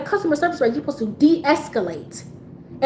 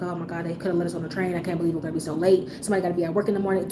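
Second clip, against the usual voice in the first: low, soft muttered complaint; mumbling to herself; 5 semitones lower, 5 dB quieter, faster than usual. Retold or imitated. Traveller fretting about getting home late, mumbling to herself. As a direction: grumble it under your breath, low. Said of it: "I started mumbling to myself".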